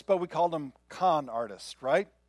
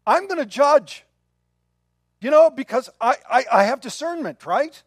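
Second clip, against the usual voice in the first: fervent preaching; 7 semitones higher, 9 dB louder than usual. Real, not acted. Heated preaching climax.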